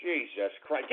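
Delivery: ordinary talk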